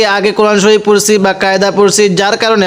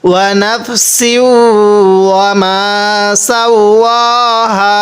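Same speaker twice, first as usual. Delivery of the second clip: slow, drawn-out tajweed chant; Quran recitation; much slower than usual. Shown as a demonstration. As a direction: recite each word slowly with long vowels. Teacher recites verse after spelling.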